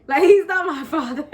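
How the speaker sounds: retold protest dissolving into laughter; laughing mock denial. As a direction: imitate indignant denial while starting to laugh.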